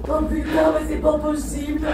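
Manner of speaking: high, panicked exclamation; frantic disbelief while fleeing. Real, not acted. Panicked escape attempt.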